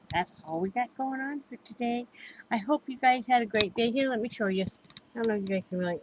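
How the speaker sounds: ordinary delivery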